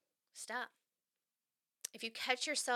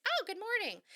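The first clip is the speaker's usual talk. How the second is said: high, sing-song cheery hello; chipper greeter; 11 semitones higher than usual. Retold or imitated. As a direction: imitate an overly bright morning person. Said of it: "somebody's just so chipper".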